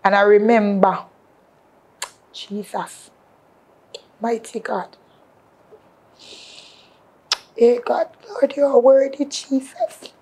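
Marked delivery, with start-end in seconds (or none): 2.3-10.1 hushed, breathy prayer to God, almost unvoiced; soft worshipful whisper of praise. Real, not acted. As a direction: pray under your breath in awe.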